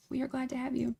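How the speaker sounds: hushed second welcome. Real, not acted. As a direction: drop the repeat right down, hushed. Repeating the welcome softer.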